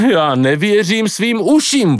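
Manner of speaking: high, slow cry of disbelief; calling out, incredulous. Acted. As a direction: exclaim it stretched and high, appalled. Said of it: "volal Pako". (nevěřícné, vysoké volání)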